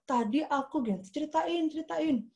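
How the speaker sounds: high, childlike imitated voice; young nephew reporting. Six-year-old nephew telling his aunt, childlike and high. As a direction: pipe up like a child with news. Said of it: "dia datang ke saya".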